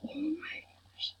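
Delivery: hushed, breathy amazement; awed whisper. Real, not acted. Player overwhelmed as the game loads, awed whisper. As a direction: whisper it, overcome.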